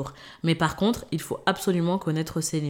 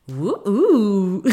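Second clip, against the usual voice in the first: excited whoop; 4 semitones higher, 4 dB louder than usual. Real, not acted. Cheer after her English quote.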